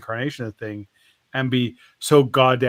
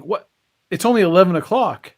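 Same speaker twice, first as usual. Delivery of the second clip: higher, louder, quick objection; acted outrage at the early closing; incredulous protest; 5 semitones higher, 8 dB louder, faster than usual. Shark protesting to the bartender about closing time, incredulous.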